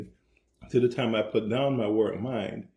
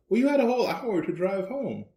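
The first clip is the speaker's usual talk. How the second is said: higher-pitched mock questioning of a spouse; retold challenge; 10 semitones higher than usual. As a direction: lightly imitate a wife challenging her husband. Retold quarrel, the wife questioning him.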